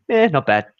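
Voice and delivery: mock bland, noncommittal answer; a lightly voiced shrug of a reply. Imitating a vague person answering how are you, offhand. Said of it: "vague as a human being and you're bland".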